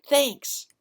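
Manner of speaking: put-on little girl voice. A narrator's reading.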